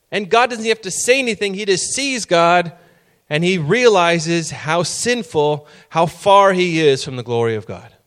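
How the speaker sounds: high, loud, fervent preaching, slowing at the end; preaching at full force. Acted. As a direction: drive home the prophet's dread. Preacher builds to a climax.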